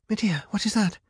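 high, alarmed question; gasping. A narrator's reading. Rapunzel sees swirling colours ahead, gasping. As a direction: gasp it out in alarm. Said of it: "Rapunzel gasped".

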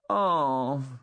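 put-on high, disappointed little-boy groan; grumbling child. A narrator's reading. Small son giving up his climb, grumbling. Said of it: "Hugh grumbled".

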